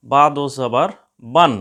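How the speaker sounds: loud, clearly chanted "বা দো জবর বান", each syllable separate; recitation drill. Shown as a demonstration. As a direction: recite it like a Qaida drill for students. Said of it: "দেখুন বা এর পরে নুন সাকিন". (জোরে স্পষ্ট করে পড়া)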